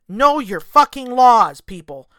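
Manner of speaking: loud and slow, hammering each word; loud slow emphatic warning; emphatic shout. Real, not acted. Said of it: "I'm going to emphasize".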